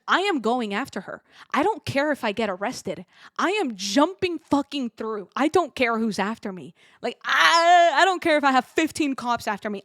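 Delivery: emphatic, building to a loud cry; fierce determination. Retold or imitated. Vowing to chase the cat past airport security, fierce determination.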